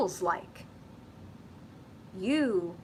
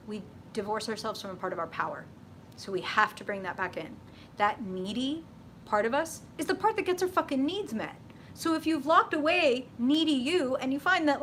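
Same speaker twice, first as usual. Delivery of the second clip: ordinary talk, nothing marked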